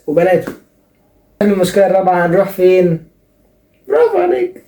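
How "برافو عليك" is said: high, sarcastic bravo; mock bravo. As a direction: praise him in a squeaky mocking burst. Mock praise to caller.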